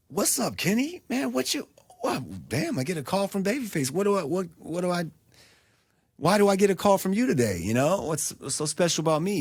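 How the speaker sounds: high-pitched, animated phone greeting; lively retelling of an astonished pickup; pleasantly surprised. Retold or imitated. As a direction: act out a flattered, surprised executive on the phone. Acting out surprised executive taking call.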